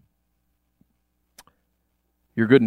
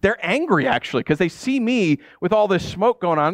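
ordinary delivery

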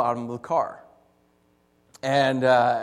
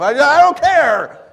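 high, loud, drawn-out frustrated yell; hammed-up fed-up shout; exasperated outburst; 10 semitones higher, 12 dB louder, slower than usual. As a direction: blow up over petty paperwork. Outburst over permit paperwork.

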